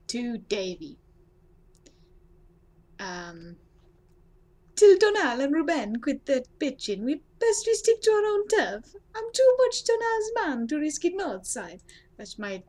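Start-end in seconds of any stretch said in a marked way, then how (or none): 4.8-11.8 high, loud attempt at an Italian accent; over-the-top amateur Italian accent; bad Italian accent. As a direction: read it out in a hammed-up Italian accent. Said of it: "Italian accent I was trying to do".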